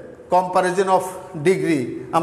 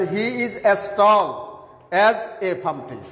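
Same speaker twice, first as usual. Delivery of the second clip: very slow, word by word; slow, deliberate example sentence; slow example reading; much slower than usual. Shown as a demonstration. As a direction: dictate "He is as tall as a palm tree" slowly. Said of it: "যেমন এখানের মধ্যে আসে".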